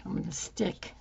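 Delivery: strained fading voice; losing voice. Real, not acted. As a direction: voice giving out. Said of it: "I'm losing my voice".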